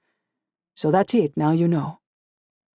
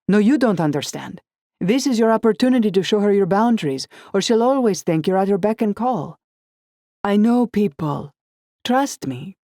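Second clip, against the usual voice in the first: put-on higher, louder controlling boyfriend; Dante insisting; 5 semitones higher, 5 dB louder than usual. Boyfriend lecturing her about her boss, forceful and higher. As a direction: press her hard, sure you know best.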